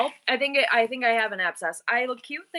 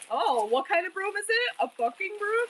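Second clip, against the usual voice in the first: higher, questioning mock-kid voice; childish mimicry; 6 semitones higher, 3 dB quieter than usual. Mom imitating her son repeating her swearing, childish questioning. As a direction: mimic a kid gleefully parroting, pitch rising. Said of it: "What are you, a child?".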